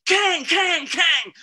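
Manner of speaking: very high, loud clanging kang kang; shrill mimicked clangs on a hull; imitated metal clang. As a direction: ring it out like logs hitting a metal boat. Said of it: "this is a metal boat".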